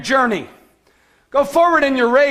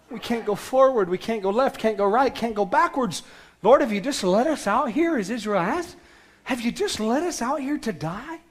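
softer, lower hopeless complaint; despairing lament; 3 semitones lower, 6 dB quieter than usual. Retold or imitated. Trapped Israelites asking the Lord if they'll die, quietly despairing. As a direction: sink into quiet despair, trapped on every side. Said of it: "they begin to cry and say".

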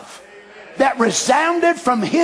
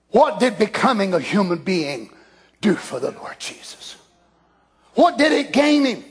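ordinary delivery

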